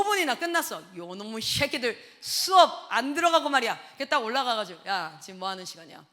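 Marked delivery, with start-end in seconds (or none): 1.0-3.8 put-on louder irritated mutter at the kids; annoyed grumble. 4.9-6.0 lowered stern voice. Retold or imitated. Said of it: "제가 딱 목소리 깔면".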